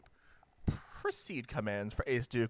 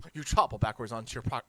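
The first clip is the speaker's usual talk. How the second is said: usual speaking voice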